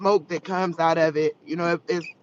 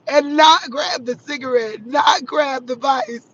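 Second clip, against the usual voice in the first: tearful shout; 6 semitones higher, 5 dB louder than usual. Retold or imitated. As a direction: plead with the listeners through tears. Retelling God's warning with tears.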